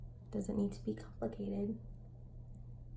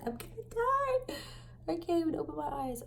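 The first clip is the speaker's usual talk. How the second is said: mock dying; 9 semitones higher than usual. Retelling her sickness to viewers, mock dying whine. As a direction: ham up being deathly sick. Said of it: "I was like".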